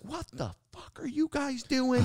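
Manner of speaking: very high, strained screech without volume; mock screaming woman. Woman yelling at tree cutters at noon, mock screech. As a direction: imitate an outraged woman screeching. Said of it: "she's screaming".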